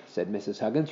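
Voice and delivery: usual speaking voice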